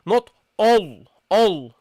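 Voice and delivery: all said dark and heavy as the wrong take; mock the heavy start on all; teacher mimics wrong pronunciation. Shown as a demonstration. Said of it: "don't make it heavy".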